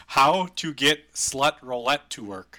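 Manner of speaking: slow reading aloud. Shown as a demonstration. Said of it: "Question mark".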